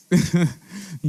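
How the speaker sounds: brief emotional chuckle; short laugh. Real, not acted. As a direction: let a laugh slip out. Emotional laugh mid-sentence.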